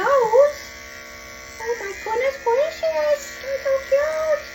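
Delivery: very high, slow gushing coo. Real, not acted. Groomer gushing over the dog's cute face. High baby talk to dog.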